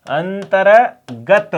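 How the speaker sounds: अंतर्गत stretched slowly with a rise; slow demo. Shown as a demonstration. Teacher slowly sounding अंतर्गत for class. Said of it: "ग के ऊपर र लगेगा".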